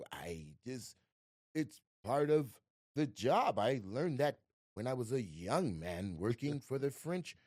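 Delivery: slow, halting, refined imitation of an actor; John Malkovich impression. Veteran actor recalling his youth, slow and refined. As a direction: savor each phrase like a cultured veteran. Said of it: "you talk like you were born in a vineyard".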